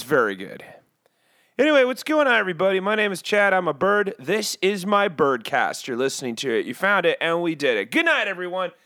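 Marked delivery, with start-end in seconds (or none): none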